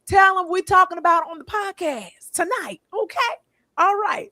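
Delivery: high-pitched, excited plug for the podcast. Real, not acted. Host urging viewers to spread the word about tonight's podcast, excited. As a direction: push it out high, really excited. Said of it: "I'm just excited".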